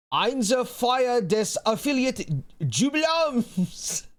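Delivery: slow, high, exaggerated German read; hammed-up German reading. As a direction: stumble through the German with mock flair. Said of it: "you really gonna make me read German".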